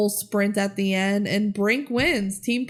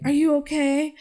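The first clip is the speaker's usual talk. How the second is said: concerned Brink; 5 semitones higher than usual. Retold or imitated. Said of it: "He's like".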